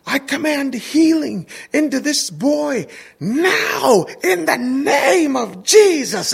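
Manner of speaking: high, slow, ringing preacher cry; retold healing command yell. Dad commands healing over a little boy, yelling it out. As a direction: imitate a preacher commanding a healing, every word stretched. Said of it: "That's what he yelled".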